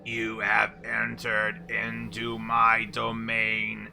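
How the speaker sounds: slow, loud robotic boss voice; mechanical monotone voice. Acted. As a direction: boom it out like a machine overlord. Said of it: "you hear this loud, mechanical, monotone voice".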